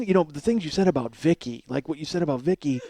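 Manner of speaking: normal talking voice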